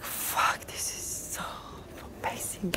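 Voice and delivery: breathless whisper of disbelief; barely voiced, hushed amazement; awed whisper. Awestruck on arrival in Ibiza.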